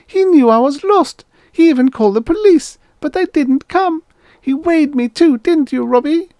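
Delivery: put-on very high, loud child chatter; babbling little girl. A narrator's reading. Little girl tells mother what robot did, babbling. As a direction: tumble it all out like a small child. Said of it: "the little girl began babbling at her".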